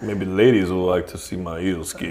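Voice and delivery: low, soft, grave wrestler voice; Razorblade. Acted. Wrestler explaining his costume in interview, low solemn voice. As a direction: tell the origin solemnly and low. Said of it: "Serious interview with Razorblade".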